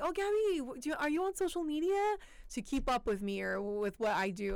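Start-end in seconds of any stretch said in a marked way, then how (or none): 0.0-2.2 eager acquaintance voice. Retold or imitated. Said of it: "they're always like".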